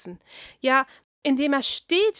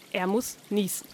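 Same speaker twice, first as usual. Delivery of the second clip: plain reading voice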